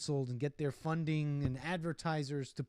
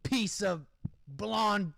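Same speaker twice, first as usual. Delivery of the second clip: hammed-up outburst, higher and louder; mock insult rant; 6 semitones higher, 4 dB louder, slower than usual. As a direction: spit out mock insults, piece by piece. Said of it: "even if she said".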